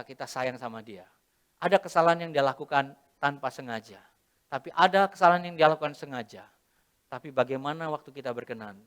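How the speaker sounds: usual speaking voice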